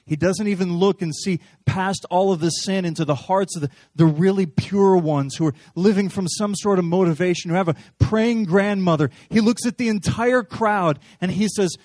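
loud, rising preaching; fervent loud sermon; preaching at full force. Acted. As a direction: drive it home from the pulpit.

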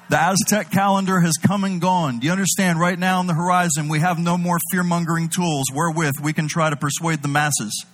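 full-force preacher delivery; preaching. Acted. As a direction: drive it home to the congregation.

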